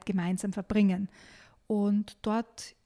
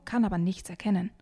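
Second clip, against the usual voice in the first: ordinary delivery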